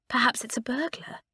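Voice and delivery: scared breathless whisper; shaking voice. Acted. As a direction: whisper it, trembling with fright. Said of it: "suggested Doris in a shaking voice".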